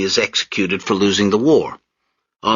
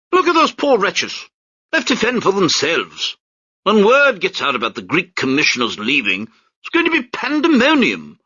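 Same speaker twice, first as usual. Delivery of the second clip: high, louder toffish officer voice; pompous British major; 12 semitones higher, 4 dB louder than usual. Acted. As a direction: sneer down at the crowd from the deck.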